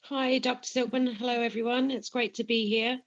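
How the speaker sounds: ordinary talk, nothing marked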